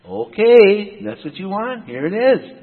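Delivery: high, loud drawn "okay"; exasperated giving-in. Acted. Prophet gives in to the king demanding truth, exasperated. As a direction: give in pointedly, pitched well up.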